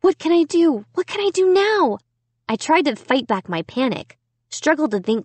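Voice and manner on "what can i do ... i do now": panicky. A narrator's reading. Trapped, door giving way.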